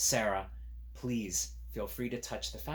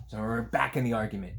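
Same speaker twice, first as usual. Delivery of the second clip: ordinary delivery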